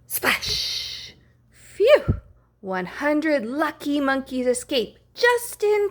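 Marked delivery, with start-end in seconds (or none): 1.5-2.2 high loud rising phew; a big storybook sigh of relief; relieved phew. Acted. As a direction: blow out the relief. Monkeys escape.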